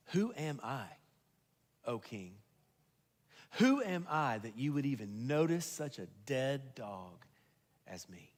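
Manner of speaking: low, hushed, humble question; humbled disbelief. Acted. Outcast to his king, humbled and hushed. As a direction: let it sink away softly, unworthy.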